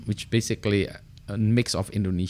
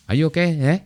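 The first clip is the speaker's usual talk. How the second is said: higher, louder Canadian "Are you okay, eh?" with a tag eh; Canadian style; 8 semitones higher, 6 dB louder, faster than usual. Shown as a demonstration. Showing viewers the Canadian eh at a sentence end, in Canadian accent. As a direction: lightly play a Canadian checking on someone. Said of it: "that's kind of Canadian style".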